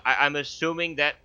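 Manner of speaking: normal talking voice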